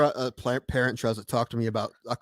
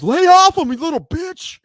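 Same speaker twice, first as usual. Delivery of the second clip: much higher, louder shout from far away; heckler yell; 10 dB louder, slower than usual. Retold or imitated. Coach yelling at the umpire from the stands, furious shout. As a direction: bellow it from the bleachers.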